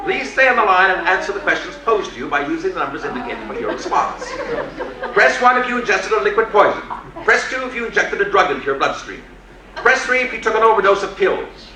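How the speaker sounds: brisk machine reading instructions; automated voice. Retold or imitated.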